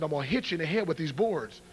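ordinary talk

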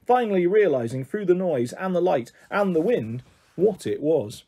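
ordinary delivery, nothing marked

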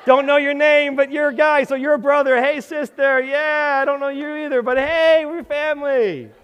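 high, loud, hammed-up church greeter; a high, loud folksy "hey, brother" bit; mock churchy greeting. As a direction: play the cheery greeter who forgot every name. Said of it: "use the brother-sister thing".

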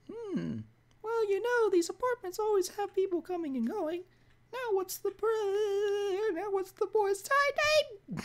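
high-pitched cartoon old lady; Mickey Mouse voice. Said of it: "why do I sound like Mickey Mouse".

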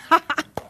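a short hearty laugh. Real, not acted. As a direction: laugh at yourself. Laughs at own joke.

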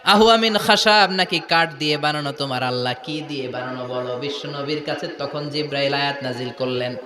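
descend gradually into low intoned storytelling; intoned sermon. Acted. Preacher telling how Gabriel brought the answer, intoned sermon.

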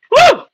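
high, loud "woo"; short high-pitched whoop; delighted whoop. Real, not acted. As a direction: whoop at how good it tastes. Reacts to tasting the food.

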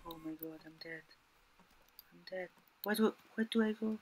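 barely audible, frightened murmur; hushed panic. Real, not acted. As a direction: keep it very soft so nobody hears you. Hiding from the homeowner, terrified.

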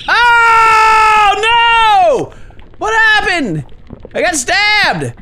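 high, loud wail of shock; hammed-up horrified yelling; panicked scream. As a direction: shriek as if just stabbed. Game worm suddenly stabbed.

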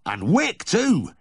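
loud Yorkshire farmer, pitched high; exclaiming farmer. A narrator's reading. Farmer admiring the lively newborn calf, loudly exclaimed. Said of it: "exclaimed the farmer".

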